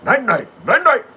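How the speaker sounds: high, loud, sing-song night-nights; a cheery, high, loud singsong goodnight. Retold or imitated. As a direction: chirp goodnight like a cartoon. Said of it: "I said".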